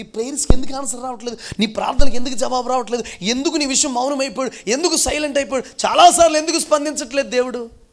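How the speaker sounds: high, loud rapid-fire pulpit questions; full-force preaching. Acted. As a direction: hammer each question harder. (గట్టిగా ప్రశ్నిస్తూ ప్రసంగం)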